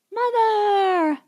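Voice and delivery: put-on high child's voice calling out. A narrator's reading. Little boy Bobbity calls for his mother from his crib, high child's voice. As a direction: call out like a small child in the night. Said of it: "a child's voice calling".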